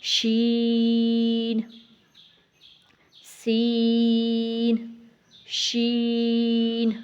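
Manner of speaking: شین and سین drawn out clearly, not nasal; slow clean شین, سین; correct letters. Shown as a demonstration. Teacher models the right way. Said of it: "کیسے پڑھنا ہے؟".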